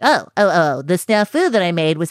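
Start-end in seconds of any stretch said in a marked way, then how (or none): none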